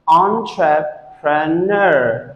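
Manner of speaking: ENTREPRENEUR slowly as on, truh, pruh, NUR; broken into syllables. Shown as a demonstration. Teacher breaks the word apart for students, broken into syllables. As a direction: separate each syllable of entrepreneur. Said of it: "If you say it together".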